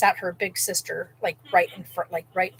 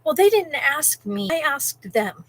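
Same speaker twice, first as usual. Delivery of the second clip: pushy lady; 6 semitones higher, 3 dB louder, slower than usual. Retold or imitated. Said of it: "and she goes".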